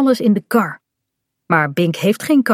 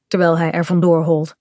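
usual speaking voice

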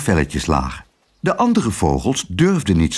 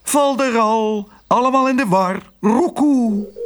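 cooing turtledove; 12 semitones higher than usual. A narrator's reading. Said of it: "schudde verwonderd haar hoofdje en riep". (hoog koerende oude tortelduif)

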